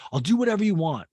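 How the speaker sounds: insistent pleading. Retold or imitated. Boyfriend gives in to girlfriend, insistent high plea. Retold offer repeated after backtracking.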